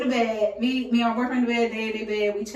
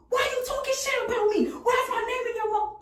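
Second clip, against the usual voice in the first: high, accusing voice of the angry guy; mock angry confrontation; 11 semitones higher, 3 dB quieter than usual. Friend bursting in on her phone call, high and accusing.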